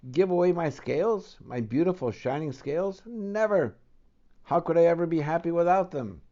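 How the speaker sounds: a proud fish aghast at giving his treasure away; indignant refusal. Acted.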